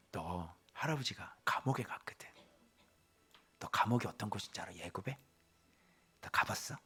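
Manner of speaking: put-on hushed grandfatherly whisper to a child. Grandfather Joseph tells his grandson about prison, whispering. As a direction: lean in like a grandpa sharing a secret.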